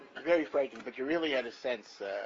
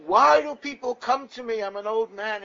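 loud, high, exasperated yell; a deaf old sage yelling in complaint; yelling old man; 10 semitones higher, 14 dB louder than usual. Retold or imitated. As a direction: bellow at the visitor, much higher and louder. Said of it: "And he yells at me".

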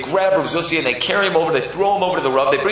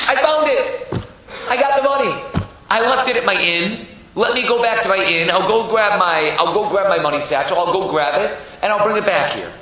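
high, loud, eager outburst; excited announcement; 7 semitones higher, 4 dB louder than usual. Acted. Zusha telling the woman he found her money, excitedly. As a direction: burst out with thrilled news.

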